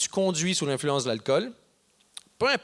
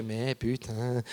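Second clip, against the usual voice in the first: discouraged chief; 7 semitones lower, 7 dB quieter, much slower than usual. Retold or imitated. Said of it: "il est découragé".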